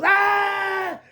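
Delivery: long, high, loud shriek; startled high "Ah!"; frightened scream. Acted. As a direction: scream in storybook terror. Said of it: "Fergie goes".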